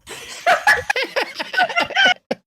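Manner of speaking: very high helpless laugh; burst of laughter. Real, not acted. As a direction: crack up uncontrollably. Cracking up next to coworker.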